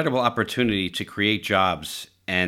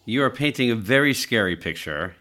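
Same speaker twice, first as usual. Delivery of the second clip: ordinary delivery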